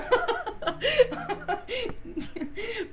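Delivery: a high, real laugh at herself; high burst of laughter. Real, not acted. Laughs at her own envy.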